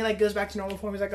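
usual speaking voice

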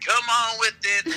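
high, drawn-out holler without full volume; mock calling out across an arena; mock arena yell. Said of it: "we would yell across the arena".

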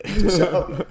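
put-on high, loud laughing French accent; over-the-top French, cracking up. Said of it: "Please say it in the French accent".

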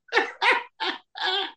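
high burst of laughter. Real, not acted. Host cracking up at co-host's chime-in, laughing. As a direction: break into a real high laugh.

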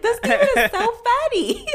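very high, laughing squeal; delighted squeal. Retold or imitated. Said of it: "I'm just like".

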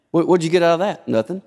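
ordinary talk

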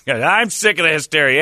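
slurred tipsy email read; drunk voice. Acted. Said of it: "I'll read it like a drunk wrote it".

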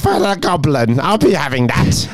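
a gleeful little goblin hoarding trinkets; greedy goblin. Pretend. Playing the goblin from letter.

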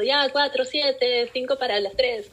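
imitating a street dispatcher calling times; street caller yelling. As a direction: announce the times like a bus dispatcher. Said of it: "el que está gritando".

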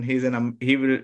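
ordinary delivery

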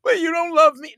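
high, loud whine; mock whiny girlfriend. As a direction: whine it like a hurt partner. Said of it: "girlfriend whines back".

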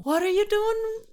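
high squeaky quote; high mock voice. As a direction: pitch it way up.

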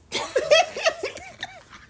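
high-pitched burst of laughter; giggling high after the joke. Real, not acted. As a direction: squeal of a laugh. Laughing at her own remark.

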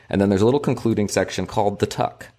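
usual speaking voice

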